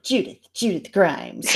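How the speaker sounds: self-important spy-style introduction, lightly put on; mock James Bond. Fan mocking Judith putting on her hat, mock Bond introduction. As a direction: announce the name like a suave secret agent, mockingly. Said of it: "like, like James Bond".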